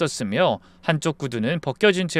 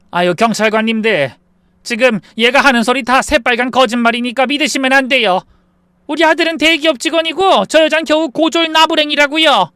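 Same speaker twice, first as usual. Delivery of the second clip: loud, high protest building in pitch; sudden angry shouting; 9 semitones higher, 6 dB louder than usual. Acted. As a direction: burst out, discrediting her to the police. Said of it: "버럭 소리를 질렀습니다".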